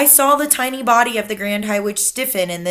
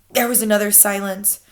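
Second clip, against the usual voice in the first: usual speaking voice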